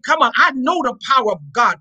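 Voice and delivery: ordinary delivery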